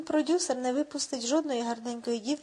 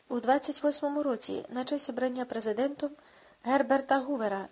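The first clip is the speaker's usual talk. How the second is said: usual speaking voice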